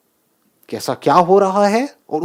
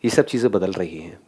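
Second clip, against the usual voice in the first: ये सब चीजें बदल रही हैं said much lower and softer; quiet reflective; 10 semitones lower, 7 dB quieter than usual. Shown as a demonstration. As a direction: drop it low and soft, letting it sink in. Repeats the phrase low, after a pause.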